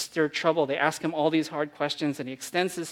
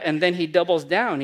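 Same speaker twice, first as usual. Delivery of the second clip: ordinary delivery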